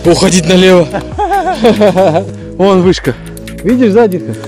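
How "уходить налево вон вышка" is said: normal talking voice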